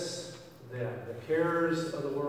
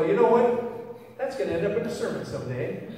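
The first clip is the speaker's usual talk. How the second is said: playful teasing; 4 semitones higher, 6 dB louder than usual. Retold or imitated. Telling shooter it goes in sermon.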